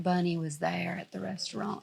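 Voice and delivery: lightly imitated Southern drawl, soft and slow; a hint of a Southern author reading aloud. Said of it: "She's got a Southern drawl".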